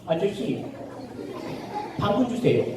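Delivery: tiny, soft rabbit voice; little rabbit. Acted. Rabbit asking the grocer for carrots, small soft voice. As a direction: ask timidly, very softly, with pauses. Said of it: "또 토끼가 와서".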